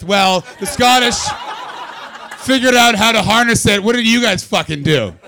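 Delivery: loud, high comic outrage; indignant shouting. Acted. Comic defending the Scottish to the crowd, indignantly shouting. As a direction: shout it as a mock-furious challenge. Mock argument with audience over steam.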